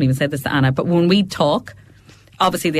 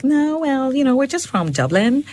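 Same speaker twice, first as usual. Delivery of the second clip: imitated high mid-Atlantic drawl; mock a Dublin lad's Americanised drawl; put-on mid-Atlantic twang, pitched up; 9 semitones higher than usual. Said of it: "mid-Atlantic drawl thing that's going on".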